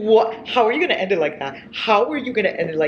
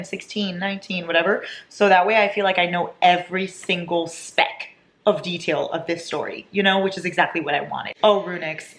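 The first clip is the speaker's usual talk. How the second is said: ordinary talk, nothing marked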